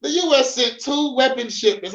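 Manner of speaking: emphatic reread. Shown as a demonstration. Rereading the headline to get to the meat, high and emphatic. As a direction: hit the headline harder. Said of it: "This way it gets to the meat and potatoes".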